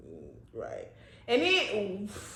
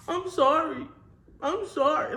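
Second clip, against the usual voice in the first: high-pitched imitation of him apologising; mock pleading apology; 5 semitones higher than usual. Boyfriend trying to take back his insults, mock apology.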